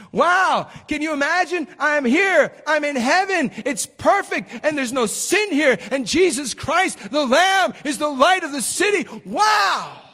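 loud, high preacher's fervor; preaching. Acted. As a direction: preach it to the back pews. Preaching at full force.

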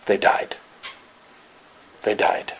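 near-whisper, very soft; hushed confession. Acted. Paul admitting his victims died, hushed. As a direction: drop to a breath of a voice.

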